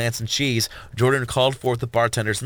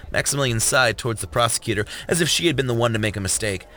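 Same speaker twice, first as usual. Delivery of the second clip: ordinary delivery, nothing marked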